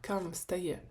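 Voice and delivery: usual speaking voice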